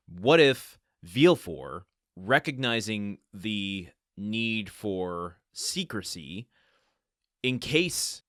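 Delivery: slow, drawn-out conspiracy musing; theorizing slowly. Real, not acted. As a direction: lay out the theory step by step. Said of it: "follow me on this train of thought".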